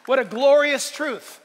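normal talking voice

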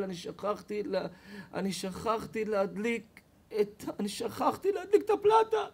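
hammed-up flustered wife; nervous wife. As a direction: stammer the confession then squeal it. Wife confessing forgot hotplate.

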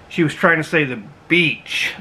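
ordinary talk

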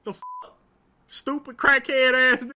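ordinary delivery

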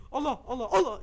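yelling in alarm as he gets grabbed; startled shout. Real, not acted. Player caught by the neighbor, a startled shout.

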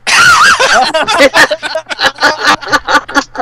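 big, loud, high-pitched laughter. Real, not acted. GM laughing at where the dagger might go, loud laugh.

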